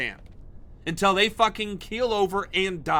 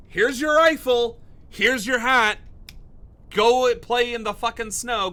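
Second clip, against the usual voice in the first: loud, higher mock recruiter handing out kit; shouting mock hand-out to volunteers; sarcastic shout; 4 semitones higher, 6 dB louder than usual. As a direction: bellow it like a gleeful drill sergeant.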